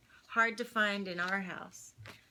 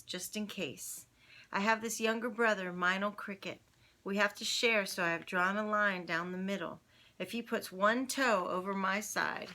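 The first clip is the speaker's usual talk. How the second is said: ordinary delivery, nothing marked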